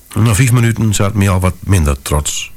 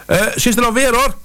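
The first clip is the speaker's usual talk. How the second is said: high woman's voice calling out; calling lady. Acted. Wife calls from the train door to the narrator, high and relieved. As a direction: call from the train door in a far higher voice. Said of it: "riep ze".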